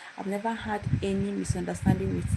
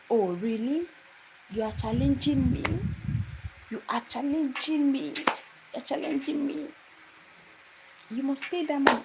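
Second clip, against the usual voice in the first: indignant lecturer; 6 semitones higher than usual. Retold or imitated. Lecturer confronting the student, high indignant challenge. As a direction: throw the question back at her in outrage.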